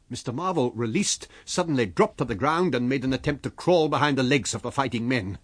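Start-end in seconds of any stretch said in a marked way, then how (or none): none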